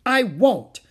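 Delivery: put-on high, loud childish refusal; defiant yell. A narrator's reading. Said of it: "yelled Howie".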